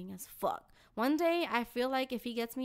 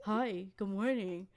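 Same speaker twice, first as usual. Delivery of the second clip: slow, lower creepy whisper-close greeting; creepy old man imitation; 4 semitones lower, slower than usual. Mimicking the old man greeting her on the street, low and slow. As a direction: lean in and drawl it like a creep. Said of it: "head close to mine and be like".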